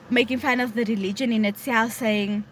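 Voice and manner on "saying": normal talking voice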